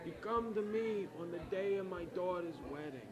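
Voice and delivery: soft, squinting Italian-American mobster accent; quiet mock Don Corleone in Italian-American accent; hushed Italian-American godfather voice; Godfather impression. Shown as a demonstration. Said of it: "I do this so they know it's an Italian-American film".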